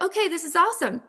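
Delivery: high, fast and upbeat; excited. Retold or imitated. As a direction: bounce back up with joy. Imagined owner back on top.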